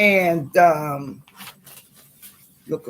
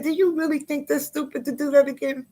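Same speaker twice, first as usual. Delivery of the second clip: quoting the agent; 9 semitones higher, faster than usual. Retold or imitated. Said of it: "She said".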